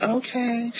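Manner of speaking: soft high falsetto okay; a tiny soft high okay; breathy high okay. Retold or imitated. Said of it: "And he be like".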